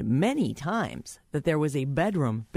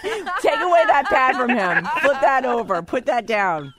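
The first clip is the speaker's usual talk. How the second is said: loud; 11 semitones higher, 8 dB louder than usual. Real, not acted. Catches him cheating on iPad.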